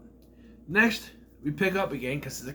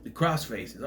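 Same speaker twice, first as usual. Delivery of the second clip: ordinary delivery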